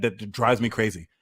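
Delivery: usual speaking voice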